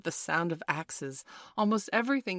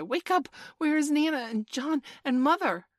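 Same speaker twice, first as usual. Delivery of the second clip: high, loud plaintive calling; child's cry; 10 semitones higher, 7 dB louder than usual. A narrator's reading. Michael asks for Nana and Mother, crying out. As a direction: cry out to rouse a sleeper. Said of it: "Michael would cry".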